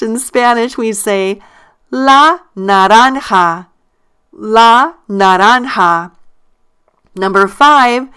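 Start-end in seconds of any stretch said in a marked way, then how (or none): 1.9-6.1 "la naranja" slowly, stress on RAN; stressed syllable. Shown as a demonstration. Teacher modelling the orange in Spanish, slow stressed "RAN" in "naranja". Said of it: "notice I stress the syllable".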